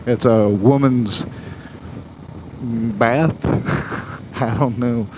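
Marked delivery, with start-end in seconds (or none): 3.4-4.4 breathy little chuckle; short amused laugh. Real, not acted. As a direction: chuckle briefly to yourself. Laughs at own commercial memory.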